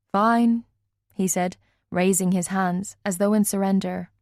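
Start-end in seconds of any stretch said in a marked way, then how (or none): none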